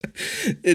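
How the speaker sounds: a sudden high laugh at himself; high burst of laughter. Real, not acted. Host laughing at his own gaming doubts, high burst of laughter.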